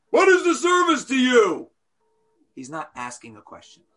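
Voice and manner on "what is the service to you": loud, higher contemptuous jab; defiant statement. Retold or imitated. Wicked child confronting the rabbi, contemptuous jab. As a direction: throw it out as a challenge, not a question. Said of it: "But he's completely".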